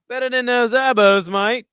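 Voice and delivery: a clumsy mock Australian accent; bad Australian accent. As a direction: ham up an Aussie bloke, badly. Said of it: "Nah, that was terrible".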